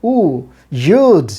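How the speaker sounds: emphatic long-vowel you'd. Shown as a demonstration. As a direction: stretch the oo in you'd. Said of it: "উটা long sound হবে এখানে".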